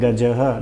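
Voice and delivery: गजः ending on an audible "ha"; sounded visarga. Shown as a demonstration. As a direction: pronounce गजः as "gaja-ha". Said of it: "ह की ध्वनि निकलती है जब उच्चारण करते हैं".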